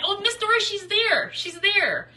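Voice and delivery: high, loud excited squeal; excited exclamation. Real, not acted. Thrilled to spot a follower, excited high calling out. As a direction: call it out, thrilled to spot her. Spotting Miss Dory excitedly.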